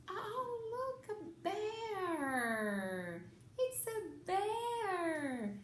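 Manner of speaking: very high cooing voice, slow and sing-song; baby talk. Shown as a demonstration. A mother showing her baby a bear in English, high slow cooing. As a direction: delight a baby with a toy bear. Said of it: "quando você vai falar inglês".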